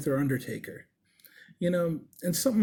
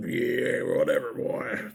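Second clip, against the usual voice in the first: put-on growled, low old Brooklyn man; old Brooklyn accent; 9 semitones lower, slower than usual. Piercer imitating the hunched old husband, growly old Brooklyn accent. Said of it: "just had this old Brooklyn accent".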